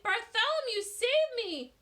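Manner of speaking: very high, loud cry for help. A narrator's reading. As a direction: scream as she sinks. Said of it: "she cried".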